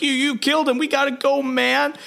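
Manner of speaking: high-pitched, play-acted alarm; mock panic. Acted panic of fellow brawler.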